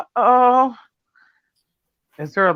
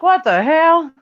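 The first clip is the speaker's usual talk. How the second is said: higher, louder mock what-the-hell; mock surprise; 9 semitones higher, 5 dB louder, slower than usual. Voicing the confused dog, louder and higher. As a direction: act baffled like the dog. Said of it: "You're like".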